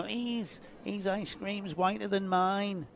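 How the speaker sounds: a poor half-hearted Verstappen whinge; Verstappen impression. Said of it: "me poor, poor impression of Verstappen whinging".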